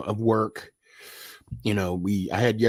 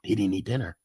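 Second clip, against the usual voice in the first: whisper; 4 semitones lower, 10 dB quieter, faster than usual. Retold or imitated. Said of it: "They'd be like".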